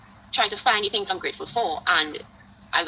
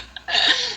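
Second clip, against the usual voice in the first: high amused laugh; 4 dB louder than usual. Real, not acted. After retold shock.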